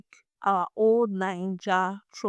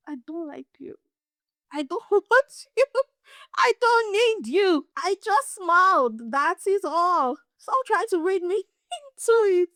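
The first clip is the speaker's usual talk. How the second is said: high-pitched, emphatic, half-laughing rejection; playfully exasperated brush-off; mock rebuff; 8 semitones higher than usual. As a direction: set an imagined admirer straight in a raised pitch. Mock rebuff of an imagined admirer.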